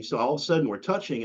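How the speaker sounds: ordinary talk, nothing marked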